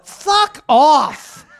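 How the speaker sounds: loud, high "fuck off"; mock outburst. Mock professor yelling at the doubters, loud and high.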